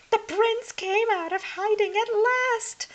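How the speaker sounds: high, loud giddy witch voice; excited stepsister. A narrator's reading. Hildy thinks the prince has come, high excited gush. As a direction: gush like a starstruck sister.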